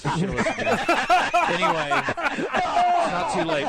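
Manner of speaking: mock regret through laughter, slow; laughing. Real, not acted. Golfer teasing his cohost about hiring him, laughing.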